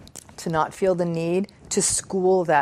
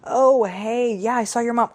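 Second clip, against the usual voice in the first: chirpy passing acquaintance; 5 semitones higher than usual. Acted. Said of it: "people stop you and say".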